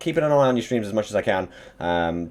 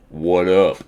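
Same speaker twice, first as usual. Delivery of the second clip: low, louder mock deep voice; deep what up; 7 dB louder than usual. Shown as a demonstration. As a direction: drop low and punch it like a dude. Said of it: "the deep one was [name] with the what up".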